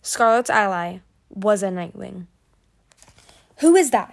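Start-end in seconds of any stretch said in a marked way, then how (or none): 3.6-4.1 higher, louder, pointed question; demanding. A narrator's reading. Winter asks who the stranger is, demanding. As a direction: demand an answer with a raised voice. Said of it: "he demanded".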